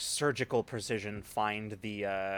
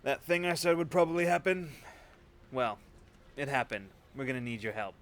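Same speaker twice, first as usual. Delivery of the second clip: Galt to the driver; 3 semitones higher than usual. Acted. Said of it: "he goes".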